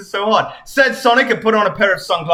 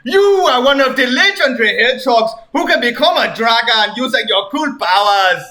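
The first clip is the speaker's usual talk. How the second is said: lightly voiced king; 6 semitones higher, 5 dB louder than usual. King Tunt explains.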